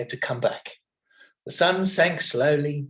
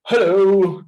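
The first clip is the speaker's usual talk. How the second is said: loud, friendly hello; a loud, cheerful hello; friendly cry; 5 semitones higher, 10 dB louder than usual. A narrator's reading. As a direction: greet them cheerfully. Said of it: "cried a friendly face".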